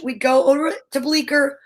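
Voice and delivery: ordinary talk, nothing marked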